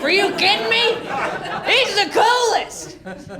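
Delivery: put-on high, excited kid voice bragging about dad. Schoolkid boasting to a classmate, high excited kid voice. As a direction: play a little kid boasting to a friend.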